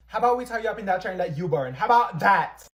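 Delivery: fast, loud, mocking threat that peaks at the end. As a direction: fire it back defiantly and loudly. Student threatens teacher.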